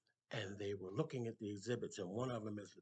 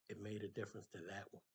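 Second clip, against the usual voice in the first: gentle reply; 4 semitones lower, 8 dB quieter, faster than usual. Acted. Saver's gentle reply to stranger.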